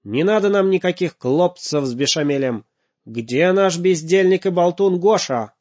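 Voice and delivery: angry father. Acted. Father furious at the robot.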